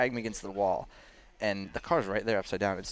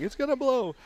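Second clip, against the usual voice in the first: mock panicked lady. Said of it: "she's like".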